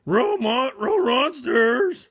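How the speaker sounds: high, loud dog-talk yell; terrified cartoon dog shout; Scooby shout. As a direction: bark out the warning like Scooby. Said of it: "Scooby cried".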